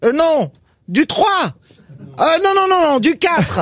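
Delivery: escalating shout. A narrator's reading. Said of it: "elle crie". (la pharmacienne crie de plus en plus fort)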